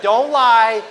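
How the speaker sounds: ordinary delivery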